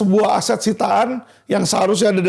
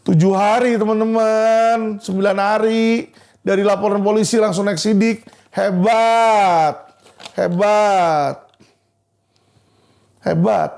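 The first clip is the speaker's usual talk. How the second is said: slow, drawn-out mock admiration; sarcastic marvelling; slower than usual. Real, not acted. Lawyer telling followers of a nine-day case, sarcastically amazed. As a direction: stretch the last syllables in sarcastic praise.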